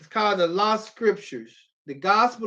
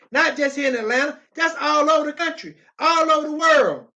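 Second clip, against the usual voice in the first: preaching swell, loud at the close; rising preacher shout; 6 semitones higher, 3 dB louder than usual. Real, not acted. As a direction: climb up into a shout by the end. Preacher building to a climax.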